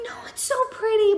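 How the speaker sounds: high, fast gushing mom voice; mock mom gushing. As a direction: gush like an overexcited mom. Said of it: "my mom's like".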